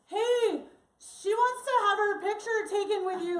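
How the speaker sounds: high, loud call-out; Ovidio. Retold or imitated. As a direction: yell across to a stranger. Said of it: "When he yells out".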